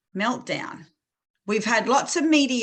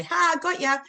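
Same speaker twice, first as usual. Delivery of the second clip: higher-pitched, sudden "ha!"; playful gotcha; 9 semitones higher than usual. Retold or imitated. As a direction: pounce on someone hiding with a playful cry. Playful pounce on hiding child.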